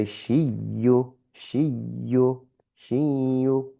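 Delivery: slow shiyu, no n before the ya; merged shiyu. Shown as a demonstration. Teacher drilling shiyu slowly with the n dropped. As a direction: say shiyu gliding straight into the y, nasalised. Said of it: "because it's a ya".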